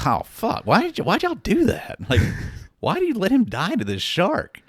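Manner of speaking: high-pitched, indignant protest; mock outrage. Viewer protesting a favorite character's shark death, comically indignant. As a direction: replay being pissed off, voice leaping high. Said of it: "I was super pissed off when he died".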